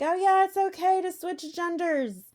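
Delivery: high mock-casual parody; mocking quote. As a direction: lampoon the idea with a bright mock cheer. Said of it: "this theory of like".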